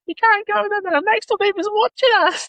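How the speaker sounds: very high-pitched mimicked voice; put-on falsetto, imitating someone; squeaky kid impression. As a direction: squeal it like a mock child. Retelling what a kid shouted.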